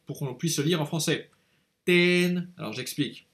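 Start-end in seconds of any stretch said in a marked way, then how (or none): 1.8-2.5 ten said high and level in Vietnamese tone; Vietnamese word ten. Shown as a demonstration. Teacher models tên with a level tone. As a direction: give Ten a high flat tone. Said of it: "c'est écrit".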